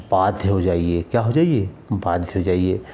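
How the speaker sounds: ordinary talk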